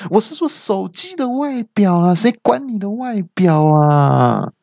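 very high-pitched mock outburst, last words drawn out; mock yelling woman. Phone owner correcting the man's misunderstanding, mock yell. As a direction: imitate an exasperated woman crying out. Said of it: "那小姐就听到就大叫说".

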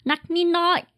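nakninoi said in Thai, na-k-ni-noi; carefully sounding out nakninoi; Thai word. Shown as a demonstration. Speaker teaching friends the Thai for harder, nakninoi. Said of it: "重一点是".

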